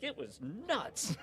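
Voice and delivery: slow, high Southern drawl; Southern accent, slow and amused. Retold or imitated. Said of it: "that was a good accent there".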